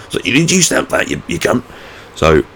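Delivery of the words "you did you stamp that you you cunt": high, strained put-on voice, falling away; imagined indignant retort in a silly high voice; mock outburst. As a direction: act out a spluttering demand. Acting out imagined retort at border officer.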